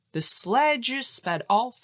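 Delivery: plain reading voice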